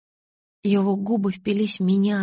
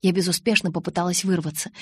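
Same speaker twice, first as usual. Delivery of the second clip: usual speaking voice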